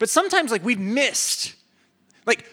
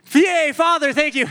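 high, loud cheering yay at Father; hammed-up party cheer; mock cheer; 8 semitones higher, 6 dB louder than usual. Joking preacher cheers Father like a party guest, mock cheer. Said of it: "bad joke".

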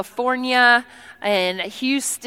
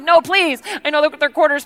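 higher, louder begging; insistent pleading; 5 semitones higher, 4 dB louder than usual. Retold or imitated. Guest urges tea lady to take quarters, raised insistent plea. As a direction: press her urgently to accept.

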